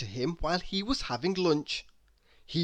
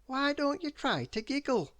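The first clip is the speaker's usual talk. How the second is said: much higher, coaxing question; hopeful; 10 semitones higher than usual. A narrator's reading. Mister Happy encourages Miss Giggles, hopeful and coaxing. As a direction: gently coax her to try. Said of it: "he said hopefully".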